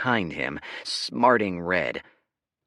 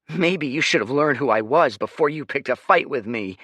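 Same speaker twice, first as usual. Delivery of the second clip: smug brat; 8 semitones higher, 8 dB louder than usual. Acted. Leo boasting.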